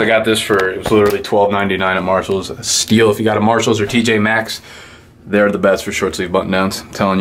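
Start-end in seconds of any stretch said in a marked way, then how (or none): none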